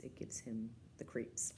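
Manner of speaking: low spooky whisper; hushed creepy aside. Real, not acted. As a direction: whisper it like a shiver.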